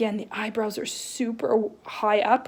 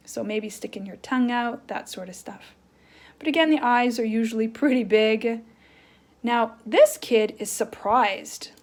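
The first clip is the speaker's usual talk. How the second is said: ordinary talk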